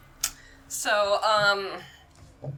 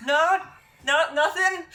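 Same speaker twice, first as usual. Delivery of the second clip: NPC voice; 12 semitones higher, 3 dB louder than usual. Acted. NPC prodding.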